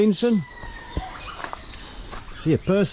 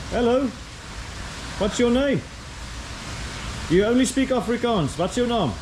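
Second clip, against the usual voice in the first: cooing at birds; 7 semitones higher than usual. Real, not acted. Greeting the birds in the cage.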